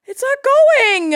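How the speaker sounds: high, slow exasperated whine; high frustrated whine. Real, not acted. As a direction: whine that it won't play. Sound effect fails to play.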